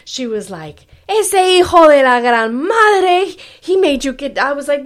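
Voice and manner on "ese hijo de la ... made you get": loud, high-pitched outraged mother; furious mom. Retold or imitated. Mother outraged at how the date treated her daughter, furious. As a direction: rant in Spanish, high and loud, as an angry mom. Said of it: "my mom was so fucking pissed".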